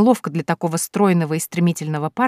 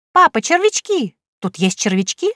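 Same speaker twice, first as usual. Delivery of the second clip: excited chick; 5 semitones higher than usual. Acted. Chick asks father excitedly.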